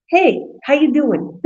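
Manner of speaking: put-on slow the greeting into a playful Italian accent. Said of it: "we gotta throw in some accent".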